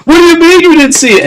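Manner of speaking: high, fast, incredulous challenge; acted exasperated outburst; indignant disbelief. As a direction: throw the excuse right back, voice way up.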